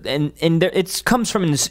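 usual speaking voice

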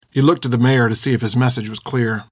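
ordinary delivery

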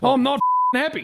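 high-pitched exasperated protest; annoyed outburst. Real, not acted. Man fed up at being denied, annoyed high protest.

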